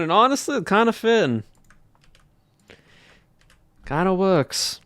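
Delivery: put-on southern accent. Streamer chatting with chat in a put-on Southern accent. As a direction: keep a Southern US accent going the whole stream. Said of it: "It's a southern accent".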